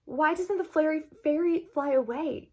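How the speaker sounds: plain reading voice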